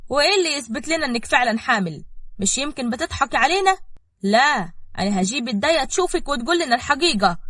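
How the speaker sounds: high, loud, sceptical challenge; incredulous and accusing; shocked disbelief. A narrator's reading. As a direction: demand proof, refusing to believe it. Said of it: "اتكلمت صفاء وهي مصدومة ومش مصدقة".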